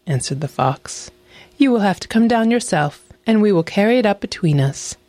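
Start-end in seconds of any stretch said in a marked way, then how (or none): 1.6-5.0 higher-pitched storybook fox; sly, persuasive fox; crafty fox. A narrator's reading. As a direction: coax the wolf down in a higher voice. Fox's voice in narrated tale.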